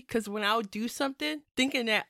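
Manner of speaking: ordinary talk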